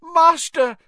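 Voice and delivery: very high, loud cry; frantic servant's scream; terrified shriek. Acted. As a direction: shriek it at the top of your range. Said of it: "he shrieked".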